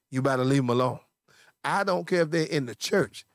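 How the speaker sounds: usual speaking voice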